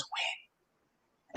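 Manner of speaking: breathy whispered single word. Retold or imitated. Podcast host mimics Adrian whispering to Rocky, hushed whisper. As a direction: whisper it weakly from a hospital bed. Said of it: "And she just whispers".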